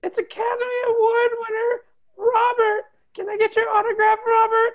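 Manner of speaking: high, squealing fan voice; gushing fan. Acted. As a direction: fawn over the star breathlessly. Starstruck fan gushing at actor.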